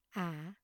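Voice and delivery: "ả" dipping low, then rising; "ả" in the low rising Vietnamese tone; hỏi dipping tone. Shown as a demonstration. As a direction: scoop "ả" down and back up, softly. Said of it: "dấu hỏi".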